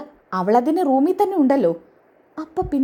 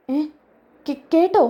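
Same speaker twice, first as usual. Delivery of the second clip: startled stammer; 4 semitones higher than usual. Acted. Caught out, daughter stammers in alarm.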